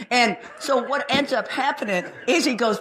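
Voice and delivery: ordinary talk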